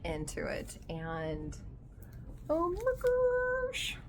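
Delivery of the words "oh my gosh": very high, slow and drawn out; creeped-out squeal. Real, not acted. Reader well into a creepy book, creeped-out squeal. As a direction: stretch it into a thin, spooked whine.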